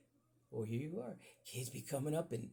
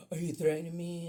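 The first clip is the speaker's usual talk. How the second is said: high, louder mock indignant question; offended accuser; 5 semitones higher, 7 dB louder than usual. People at work accuse him, mock high indignation. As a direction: play the shocked accuser.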